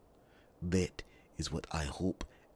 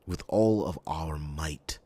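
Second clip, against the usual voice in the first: normal talking voice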